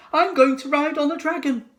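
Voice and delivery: put-on high, loud eager child's voice; a child shouting with glee about the ride; excited child cry. A narrator's reading. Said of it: "cried one".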